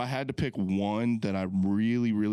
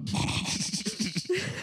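high breathy laugh; 11 semitones higher than usual. Real, not acted. Cop joke, laughs.

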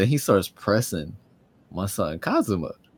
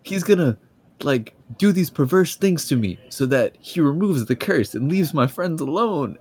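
overdramatic damsel; 9 semitones higher, 5 dB louder than usual. Retold or imitated. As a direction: wail it like a melodramatic heroine offering herself up. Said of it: "She was like".

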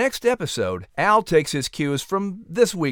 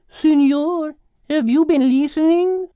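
higher, louder put-on voice for Isabella; Isabella's voice; 12 semitones higher, 5 dB louder than usual. A narrator's reading. Narrator voicing Isabella, high and insistent. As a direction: lean in with a high, bright character voice.

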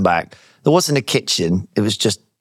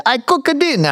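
imitating a comedy Italian accent, pitched much higher; 13 semitones higher than usual. Flatmate Chiara offering to cook, in a mock Italian accent. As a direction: put on a lightly hammed Italian accent for the flatmate. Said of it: "she has a comedy Italian accent".

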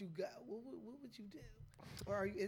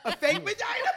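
laughing; 9 semitones higher, 12 dB louder than usual. Real, not acted. Laughing at question.

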